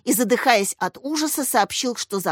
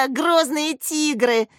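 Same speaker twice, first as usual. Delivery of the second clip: higher, slow mocking giggle; giggle, then draw the words out; giggling; 6 semitones higher, slower than usual. A narrator's reading. Yasya mocking the rival team's name, giggling. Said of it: "хихикнула Яся".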